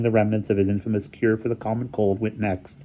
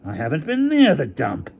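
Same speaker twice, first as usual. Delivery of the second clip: higher-pitched denial; defensive, raised-pitch rebuttal; indignant protest; 7 semitones higher than usual. Acted. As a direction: protest your innocence. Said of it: "Hamilton protested".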